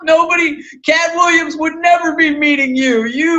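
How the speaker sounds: loud mocking heckle from the audience; heckling crowd. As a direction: yell it like a hostile crowd booing you. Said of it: "They're like".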